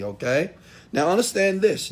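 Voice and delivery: usual speaking voice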